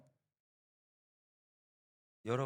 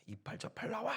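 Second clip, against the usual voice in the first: mock drunk neighbor yelling, pitched much higher; drunken yelling; 11 semitones higher than usual. Drunk neighbor cursing at the pastor's door, yelling. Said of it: "저희 아버지한테 욕을 합니다".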